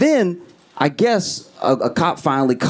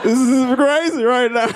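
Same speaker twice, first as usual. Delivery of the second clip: desperate prayer; 5 semitones higher than usual. Retold or imitated. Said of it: "I genuinely started to pray".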